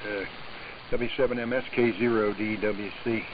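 ordinary talk

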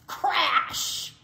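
loud, high shouted sound word; explosive crash. A narrator's reading. Narrator sounds out Bear's crash, explosive shout. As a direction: burst out with the crash. Story sound effect read aloud.

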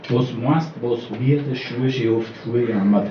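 ordinary delivery

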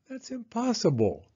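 put-on high-pitched kid protest; voiced young son. A narrator's reading. Son reacting to mom's complaint, high protesting voice. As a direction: pitch it up as the boy. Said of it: "thought Spencer".

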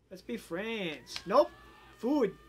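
normal talking voice

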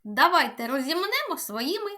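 ordinary talk

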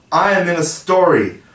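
bursting with joy; delighted. Acted. Toodles hearing his name in the story: delighted.

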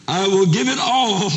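high, loud, heartfelt declaration; impassioned vow. Acted. Husband giving all for this woman, impassioned vow. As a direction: lift the voice and pledge everything. Bidder gives up everything.

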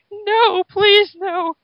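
very high, strained, pleading cry; croaked plea. Acted. Pinned by Charles, pleading in a very high strained voice. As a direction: beg in panic, voice straining high. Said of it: "croaked J F J".

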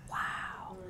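hushed, whispered wow; awed whisper. Real, not acted. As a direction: whisper it in wonder to yourself. Speaker marvels at the quote.

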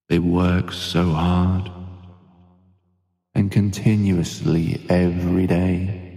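slow, soothing hypnotherapy voice with long pauses; guided sleep meditation. Acted. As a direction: ease the listener gently towards sleep, unhurried.

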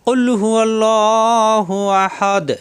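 Quran recitation. Shown as a demonstration. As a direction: recite slowly, drawing out each vowel. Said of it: "এখানে খেয়াল করুন".

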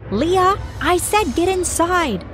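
mother screaming at daughter; screaming mom. Acted. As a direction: demand she come inside now. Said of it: "my mom screamed".

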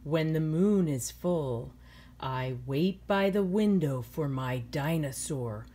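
slow, gentle storybook reading; reading to children. A narrator's reading. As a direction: read it like a bedtime story to kids. Teacher reads picture book aloud.

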